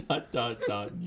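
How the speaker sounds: giggling through the words; laughing. Real, not acted. Repeating sign-off, laughing.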